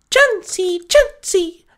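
very high-pitched little Pokemon cry; Chansey creature voice. Acted. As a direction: squeak the name like a small creature. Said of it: "Chansey said".

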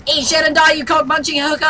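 high, loud insult; over-the-top game trash talk; yelled taunt. Pretend. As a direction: hurl it across the battlefield.

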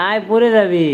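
loud, high-pitched warning; urgent warning. Real, not acted. Father warning child who may fall, urgent. Warns climbing child about falling.

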